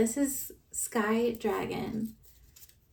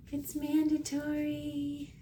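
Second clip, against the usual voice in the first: higher, slow, cutesy repeat; playful sing-song; 5 semitones higher, slower than usual. Pretend. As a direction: tease it out, high and drawn. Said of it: "I have to squish them and hug them".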